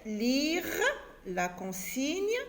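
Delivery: lire la consigne drawn out slowly, each word stressed; slow, stressed repeat. Shown as a demonstration. Teacher repeating the instruction to her pupils, slow and stressed. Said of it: "Je répète".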